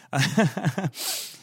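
amused laugh at his own joke; real laugh. Real, not acted. Priest laughing at his carol joke, real laugh. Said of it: "I'm sorry, I'm, I'm laughing".

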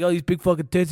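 mock Italian accent on "big fucking tits". Comedian mocking a heckler's bad Italian accent. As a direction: lightly ham up a fake Italian accent. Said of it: "I do his accent better than he does".

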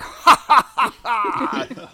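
bare the teeth and cackle like a bad actor; hammed-up fake laughter; forced cackle. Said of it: "he bares his teeth and he's like".

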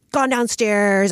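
normal talking voice

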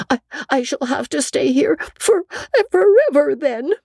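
put-on high girl's voice, mournful and halting; Anne, sorrowful, stumbling at the start. A narrator's reading. Anne telling Marilla she must stay forever, mournful. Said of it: "said Anne mournfully".

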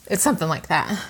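ordinary delivery

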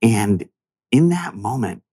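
ordinary talk, nothing marked

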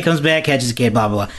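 usual speaking voice

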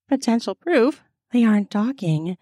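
ordinary delivery

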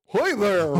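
loud, high cartoon Southern yokel; hammed-up Southern accent. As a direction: holler it in a goofy Southern accent. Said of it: "I was like".

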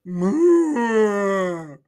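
long whale moo. Acted. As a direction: moan it out like a whale. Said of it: "playing the role of the whale".

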